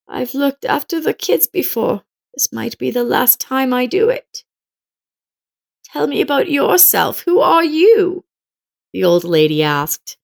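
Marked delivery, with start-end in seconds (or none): 0.1-8.2 put-on elderly neighbor woman. Old woman asks narrator.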